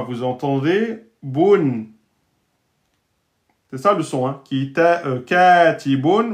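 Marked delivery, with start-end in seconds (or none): 5.1-6.3 katibun drawn out with a rising final un; slow careful Arabic. Shown as a demonstration. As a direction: stretch every syllable of katibun. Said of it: "vous entendez katibu".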